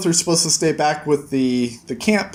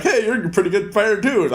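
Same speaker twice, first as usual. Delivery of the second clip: lightly voiced, higher cheerful echo of the same line; a hint of a higher friendly voice, playful copy; Tenpei's cheerful echo; 6 semitones higher than usual. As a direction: hand the compliment right back with a bright grin. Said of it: "Tenpei's like".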